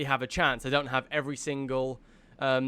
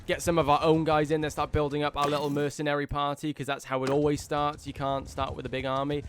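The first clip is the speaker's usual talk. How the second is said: ordinary delivery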